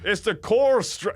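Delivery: high, botched Scottish accent; attempted Scottish accent. Shown as a demonstration. Podcaster quoting Ali McCoist without thinking, attempted Scottish accent. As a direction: blurt out a bad Scottish accent. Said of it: "you did do the accent".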